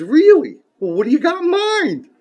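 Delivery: high-pitched, hammed-up curious pig voice; eager pig. Joking preacher plays pig answering chicken, high and eager. Pig's eager reply in joke.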